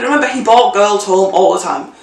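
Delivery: ordinary talk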